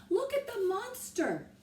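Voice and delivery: high, loud scared cry; frightened shriek. A narrator's reading. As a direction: shriek at the beast. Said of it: "a sailor shrieked".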